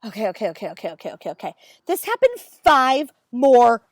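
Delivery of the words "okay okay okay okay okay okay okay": low, hushed rapid okays; flustered okays. Retold or imitated. Dermatologist mumbling okays, flustered and hushed. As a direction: mimic someone flustered and placating. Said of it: "She said".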